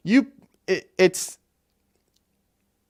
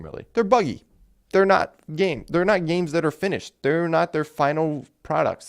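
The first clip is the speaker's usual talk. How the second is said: usual speaking voice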